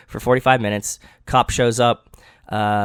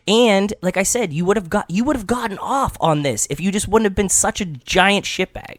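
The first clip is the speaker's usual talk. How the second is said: ranting; 7 semitones higher than usual. Real, not acted. Ranting at the hit-and-run driver.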